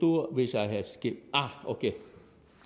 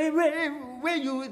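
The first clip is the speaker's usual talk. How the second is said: mock blunt call-out; 10 semitones higher than usual. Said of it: "People don't say".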